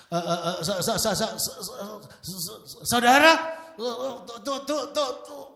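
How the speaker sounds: high, loud stuttering sermon start; mock a nervous preacher tripping on every word; stammering preacher. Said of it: "gimana sih khotbah gitu?".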